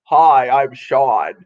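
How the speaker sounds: imitating a newly broken voice, low and slow; deepened voice. Woman mimicking her friend's changed voice on the phone, deep and slow. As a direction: drop low and draw it out, mock-manly. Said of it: "that squeaky little voice to".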